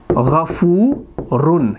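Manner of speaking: गफूरुन stretched very slowly, each sound drawn out; drawn-out Arabic reading of गफूरुन; slow Arabic dictation. Shown as a demonstration. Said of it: "अब मैं यहाँ पर लिखूँगा".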